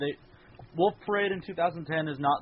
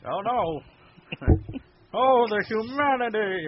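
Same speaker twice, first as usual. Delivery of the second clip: high, slow hammy radio actor; old radio drama; 8 semitones higher, 4 dB louder, slower than usual. Pretend. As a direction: ham it up like a radio serial. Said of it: "Ham radio actors now".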